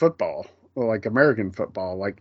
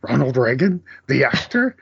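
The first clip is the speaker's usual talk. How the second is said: incredulous rising question, raised pitch; Doc Brown; 3 semitones higher, 3 dB louder than usual. Retold or imitated. Quoting Back to the Future, mock incredulous question. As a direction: lightly imitate a disbelieving movie character. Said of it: "then he does the".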